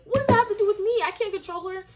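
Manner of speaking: high, indignant retold complaint; exasperated, voice climbing high; exasperated protest. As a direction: plead your innocence with the pitch pushed up.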